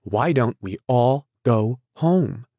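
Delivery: helpless, imploring appeal; helpless plea. A narrator's reading. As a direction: beg helplessly, stretching the words. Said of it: "she interrupted helplessly".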